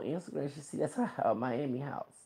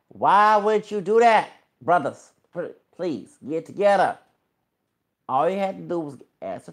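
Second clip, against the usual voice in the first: exasperated plea; 7 semitones higher, 9 dB louder than usual. Real, not acted. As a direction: plead loudly with the brothers. Pleading with rappers.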